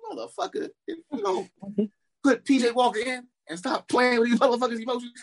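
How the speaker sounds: high, heated fan outburst; angry rant. Real, not acted. Fan yelling at his team over benching, high and heated. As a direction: blow up at the team.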